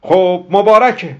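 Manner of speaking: high, drawn-out loud cheer; loud congratulation. Acted. Hamud congratulates Ghasem after kissing him, loud and high. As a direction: call out congratulations loudly and slowly. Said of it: "با صدای بلند گفت".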